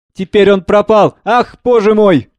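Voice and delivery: usual speaking voice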